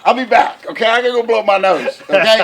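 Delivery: high, loud hammed-up anguish; mock overcome. Dad hamming up guilt at the song, loud. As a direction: cry out in playful heartbreak. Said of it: "I'm gonna go cry".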